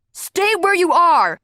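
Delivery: loud, slow, high guard yelling through a megaphone; megaphone shout. A narrator's reading. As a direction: bellow it over a loudspeaker. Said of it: "a loud voice shouted over a megaphone".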